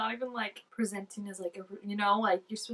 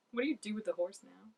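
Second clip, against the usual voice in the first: ordinary talk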